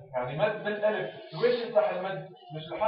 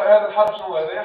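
loud teacher question; 2 semitones higher, 11 dB louder than usual. Shown as a demonstration. Teacher models question to pupil.

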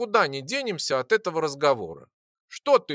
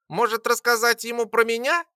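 high squeaky little voice; 9 semitones higher, 4 dB louder than usual. Acted. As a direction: squeak it from above. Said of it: "пропищало что-то сверху".

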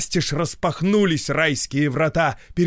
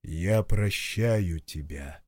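low, slow, without anger; gentle God voice; 6 semitones lower, 4 dB quieter, slower than usual. Acted. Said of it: "И не было гнева в этом голосе". (мягкий голос Бога)

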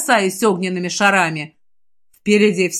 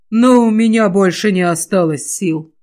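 exhausted; 3 dB louder than usual. Acted. Kostyan collapsing, exhausted.

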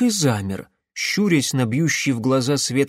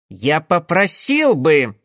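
slow, louder offended protest; indignant; 3 semitones higher, 5 dB louder, slower than usual. Acted. Гунькин обиженно возмущается в ответ Карпасу, громче и медленно. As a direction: take offence and push back. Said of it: "возмутился Гунькин".

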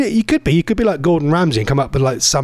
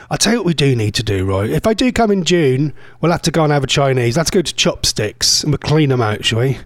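ordinary delivery

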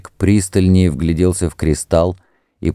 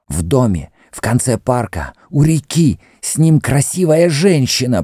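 ordinary delivery

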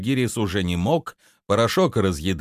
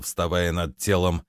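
plain reading voice

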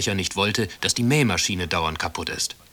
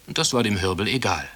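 plain reading voice

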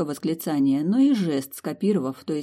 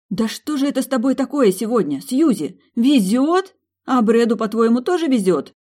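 higher, louder indignant tirade; 7 semitones higher, 7 dB louder than usual. A narrator's reading. Milly scolding Susie over Brad, loud indignation. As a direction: scold her friend in outrage. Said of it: "возмутилась Милдред".

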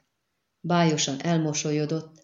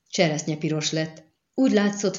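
ordinary delivery

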